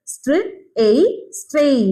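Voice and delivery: str, pause, എയ്, then joined as a stretched സ്ട്രെയ്; phonic blending. Shown as a demonstration. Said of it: "str, എയ് തന്നെ നിൽക്കുകയാണ് ട്ടോ sound". (str, എയ് പിരിച്ച്, പിന്നെ സ്ട്രെയ് ചേർത്ത്)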